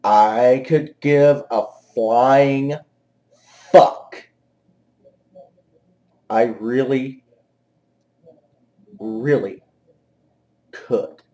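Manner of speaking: slow, low, long pauses between words; deadpan indifference. Real, not acted. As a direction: drag it out, thoroughly unimpressed. Sarcastic, deliberate indifference with pauses.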